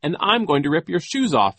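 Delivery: high, loud playful announcement; pretend joking. A narrator's reading. Klaus grabs at Genghis's feet, pretending to joke. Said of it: "as if he were still joking".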